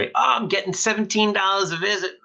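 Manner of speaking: a hammed-up grumble about a small insurance payment; mock complaint. Mocking a complaining colleague.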